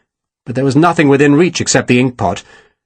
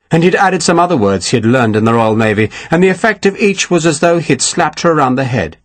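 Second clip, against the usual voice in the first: usual speaking voice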